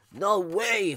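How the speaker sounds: a touch of a grumpy holler; mild holler. Acted. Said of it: "Fluffalo hollered".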